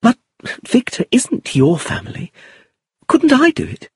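put-on high, earnest young woman; Iris, protesting. A narrator's reading. Iris offering to pay for Victor, protesting. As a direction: question him high and earnestly.

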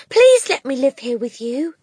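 put-on pleading child voice; pleading girl. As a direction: beg her. Matilda voiced.

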